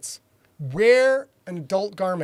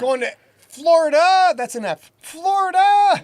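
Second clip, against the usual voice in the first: calling out; 9 semitones higher, 10 dB louder than usual. Pretend. Throwing gang sign, calls out.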